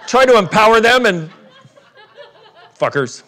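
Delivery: normal talking voice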